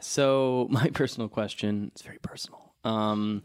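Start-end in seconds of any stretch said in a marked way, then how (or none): 1.9-2.7 hushed creepy whisper. Real, not acted. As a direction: whisper it close to the mic. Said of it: "I like the way you whispered that".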